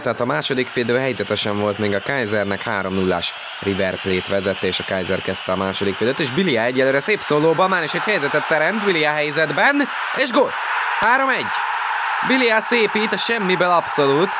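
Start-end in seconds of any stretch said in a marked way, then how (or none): 6.2-14.3 excited goal call. Real, not acted. Goal call in live commentary.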